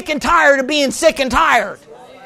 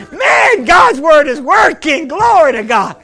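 louder, jubilant shout of praise; excited praise shout; 3 semitones higher, 4 dB louder, slower than usual. Retold or imitated. Preacher recalling his joy at answered prayer, excited shout. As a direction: cheer it out like a victory.

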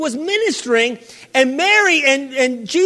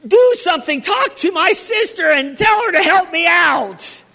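upset complaint; 5 semitones higher than usual. Retold or imitated. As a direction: demand help in frustration. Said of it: "and Martha said".

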